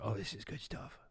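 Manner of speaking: very soft, low producer aside; hushed, low, gleeful aside; confidential aside. Retold or imitated. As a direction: lean in and murmur it secretly to a colleague. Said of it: "leaning over to the other camera".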